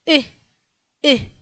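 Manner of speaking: brief chest-driven "E"; one short hiccuped "E" at a time. Shown as a demonstration. Teacher isolates Polish Y for learners, hiccup-like "E". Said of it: "como cuando tienes hipo".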